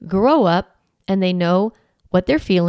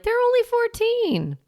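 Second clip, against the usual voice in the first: indulgent parent. Retold or imitated. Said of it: "you're like".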